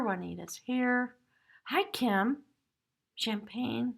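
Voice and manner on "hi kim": ordinary talk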